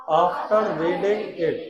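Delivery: slow, drawn-out words; slow dictation. Shown as a demonstration. Teacher dictating slowly to the class. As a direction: stretch each word for the class. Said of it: "साथ में बोला करो बेटा".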